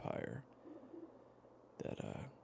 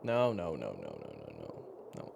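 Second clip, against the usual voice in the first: dismissive reassurance; 6 semitones lower, 4 dB louder than usual. Acted. Waves away the other's offer.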